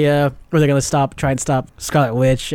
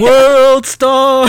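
high, loud drawn-out holler; gleeful catchphrase yell; hyped shout; 9 semitones higher, 6 dB louder than usual. Pretend. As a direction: whoop it like a rowdy spectator. Whooping catchphrase over movie moment.

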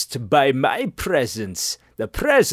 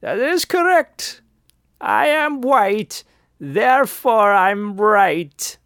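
smug declaration; 5 semitones higher than usual. Retold or imitated. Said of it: "I looked him in the eye and I said".

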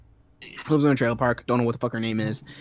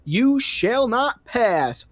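slow, higher, drawn-out wizard decree; mock Gandalf boom; 8 semitones higher, much slower than usual. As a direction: proclaim it like a movie wizard, slowly. [name] quotes Gandalf, booming.

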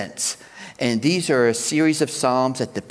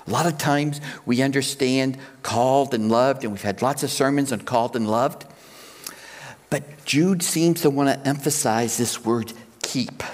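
ordinary delivery

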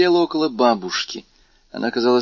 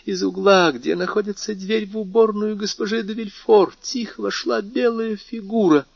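put-on high, frightened old marquise; an old lady recounting a ghost; old woman voice; 10 semitones higher than usual.

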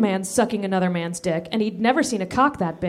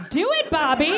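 urging; 7 semitones higher than usual. Acted. Abe urging.

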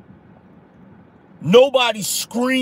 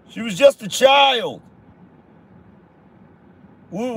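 higher, half-hearted parody of outrage; mock their tame outrage, barely raised; mock mild outrage; 4 semitones higher than usual. Mocking the family's weak reaction to the shooting, mild fake outrage. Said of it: "That's how they was".

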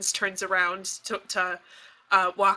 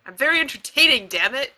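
indignant insistence; 4 semitones higher, 5 dB louder, faster than usual. Acted. Insisting she is entertaining.

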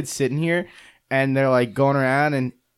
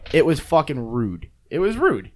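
ordinary talk

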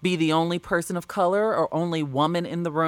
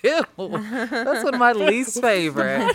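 laughing; 6 semitones higher, 4 dB louder than usual. Real, not acted. Laughing disgust at peas.

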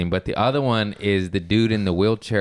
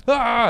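startled yelp; 13 semitones higher, 5 dB louder than usual. Retold or imitated.

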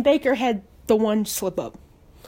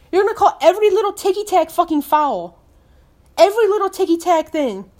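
raised-pitch real indignation; indignant complaint; 5 semitones higher than usual. Real, not acted. Fan accusing referees of petty calls, indignant.

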